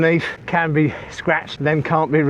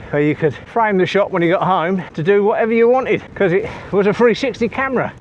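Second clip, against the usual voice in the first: ordinary talk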